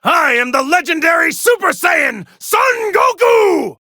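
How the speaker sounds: triumphant hero shout. Acted. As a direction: proclaim your name to the battlefield. Hero proclaims himself.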